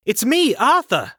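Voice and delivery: very high, loud cry; desperate yell. Acted. Arthur yelling his name at charging uncle, loud and high. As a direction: scream your name to stop him. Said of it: "I yelled".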